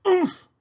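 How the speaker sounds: high, loud grunt as if struck; winded oof. A narrator's reading. Mister Watson hit by a leaping pig, winded. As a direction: let the impact knock the breath out.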